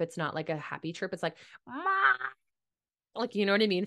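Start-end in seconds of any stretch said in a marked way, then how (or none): 1.7-2.1 high, sad squawking "Ma"; unhappy bird squawk. Retold or imitated. Imitating the Little Mermaid seagull, a high moaning squawk. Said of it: "one of those birds ... not a happy chirp".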